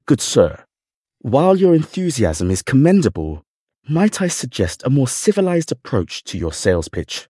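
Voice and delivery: lightly voiced refined butler; dignified butler. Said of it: "with a dignified air".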